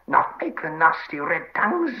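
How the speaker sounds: high, slow creature hiss; hissing Gollum. Acted. Gollum begs Sam not to make fire, hissing. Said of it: "hissed Gollum".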